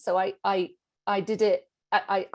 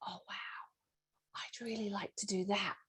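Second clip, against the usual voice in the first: breathless hushed whisper of longing; whispered inner wish; 12 dB quieter than usual. Retold or imitated. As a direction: whisper it like a secret hope. Excited inner call to growth.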